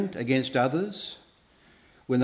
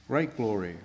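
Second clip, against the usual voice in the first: softer echo; 2 semitones lower, 5 dB quieter than usual. Shown as a demonstration. Preacher echoing his own words to the congregation, softer. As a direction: repeat great glory softer, savouring it. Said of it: "God gives them great glory".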